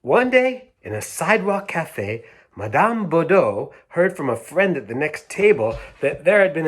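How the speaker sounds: storybook voice, starting high; lively picture-book narration; reading to children. A narrator's reading. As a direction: open the tale like a bedtime story. Reads picture book aloud.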